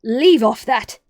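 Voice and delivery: very loud, cross royal scream. A narrator's reading. Queen scolding bowing gardeners, screamed. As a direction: yell at them to stop. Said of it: "screamed the Queen".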